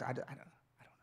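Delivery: very soft retold stammer, nearly voiceless; faltering mumble. Preacher retelling his awkward interview answer, faltering and barely audible. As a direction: imitate fumbling for an answer under your breath.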